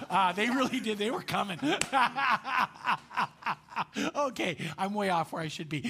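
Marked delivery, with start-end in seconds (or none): 1.6-4.1 loud, long burst of laughter; big laugh. Real, not acted. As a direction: laugh hard at your own joke. Joking about people coming forward.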